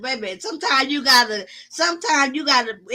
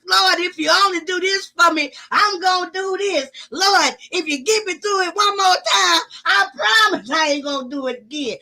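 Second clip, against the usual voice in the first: pleading prayer; 6 semitones higher than usual. Retold or imitated. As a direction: cry out to God like an emergency call. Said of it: "I was doing them nine one one".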